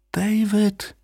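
high, soft, far-away call; distant calling. A narrator's reading. Beloved's voice reaches David's empty mind, distant call. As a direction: call the name as if from afar. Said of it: "der Klang einer Stimme".

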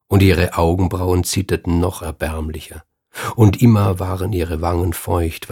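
plain reading voice

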